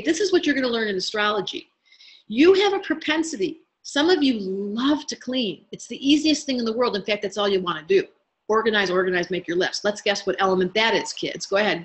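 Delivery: ordinary talk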